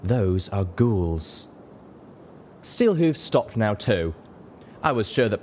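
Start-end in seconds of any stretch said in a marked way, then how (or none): 0.0-1.5 very slow, offhand delivery; casual finish. A narrator's reading. Unicorn finishes her correction, casual and slow. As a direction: draw it out casually. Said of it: "Velvet casually finished".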